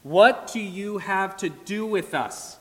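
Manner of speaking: lightly acted demon's challenge; agonized unclean spirit. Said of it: "who's in agony asks".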